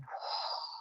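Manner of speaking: tired, long, breathy sigh; weary sigh. Retold or imitated. A burnt-out rider sighing at the kids, weary. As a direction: let the air out, worn out. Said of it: "about to burn out".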